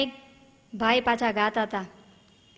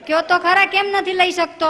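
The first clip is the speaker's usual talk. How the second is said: exasperated phone yelling; 7 semitones higher, 6 dB louder than usual. Retold or imitated. Caller shouting into phone.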